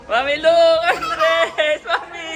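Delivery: high, excited shout through laughter; laughing call. Real, not acted. Parent calling mom to look at child, laughing.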